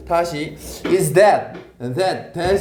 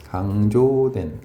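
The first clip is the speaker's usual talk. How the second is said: low, stretched 강조된, as if writing along; 강조된 at half speed, in a low pitch; slow dictation; 9 semitones lower, much slower than usual. Real, not acted. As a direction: draw out 강조된 slowly and low. Repeats gloss while writing.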